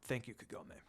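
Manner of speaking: a quiet grateful murmur; soft thanks. A narrator's reading. Sesshomaru thanking Kagome, soft and low. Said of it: "smiled back softly".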